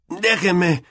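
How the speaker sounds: high-pitched protest at being held back; Ron protesting. A narrator's reading. Ron held back by Harry and Dean, protesting. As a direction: strain upward, demand to be let go. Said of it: "protestó Ron".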